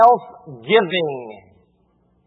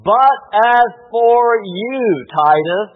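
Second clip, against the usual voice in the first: "But" drawn out and slow, louder; emphatic reading; 3 semitones higher, 4 dB louder, much slower than usual. Acted. Pastor reading verse to church with emphasis. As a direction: read slowly, leaning on "But". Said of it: "the emphatic transition".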